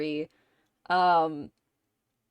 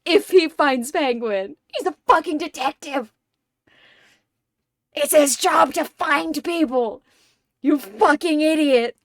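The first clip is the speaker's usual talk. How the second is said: loud, higher, exasperated scolding; enraged Riddler rant; 7 semitones higher, 8 dB louder than usual. Retold or imitated. Riddler ranting at Ed, exasperated yelling. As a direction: berate Ed like he's an idiot. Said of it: "Really being like".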